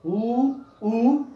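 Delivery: उ short, then उ held longer; correct vowel sounds. Shown as a demonstration. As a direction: say उ and उ as bare vowels, each on its own. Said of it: "ये होता है इसका उच्चारण".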